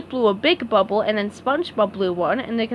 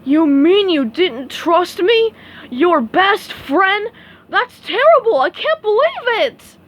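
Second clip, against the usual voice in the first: loud, high, slow outraged disbelief; a hurt starfish aghast at his friend; shocked; 8 semitones higher, 6 dB louder, slower than usual. Acted. Patrick confronts SpongeBob over distrust, loud shocked outrage. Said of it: "He was shocked".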